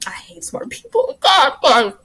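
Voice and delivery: high-pitched exasperated outburst; high, strained venting; comic rage. Real, not acted. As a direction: let mock fury squeeze the voice upward. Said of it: "it's the rage in me".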